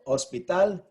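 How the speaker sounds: hospital said slowly, stressed on the final -tal; Spanish hospital, leaning on the last syllable, TAL. Shown as a demonstration. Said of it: "the last syllable is stressed".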